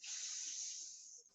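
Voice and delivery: long, very soft hissed "s" without a vowel; isolated s sound. Shown as a demonstration. Teacher showing what S says by itself, isolated sound. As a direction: say only the sound "s", not the letter name. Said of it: "S by itself says".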